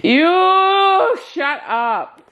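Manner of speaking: high, slow playful yell; mock shout. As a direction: shout it back, half laughing. Playful retort.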